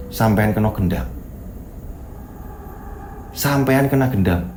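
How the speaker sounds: low, ominous guard voice; grave pronouncement. Acted. Guard telling the driver he is bewitched, grave low pronouncement. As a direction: pronounce it low, with long pauses.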